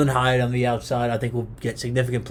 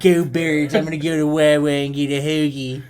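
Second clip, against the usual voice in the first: put-on Philly accent. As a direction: cheer like a Philly local. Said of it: "That's a pretty good Philly accent".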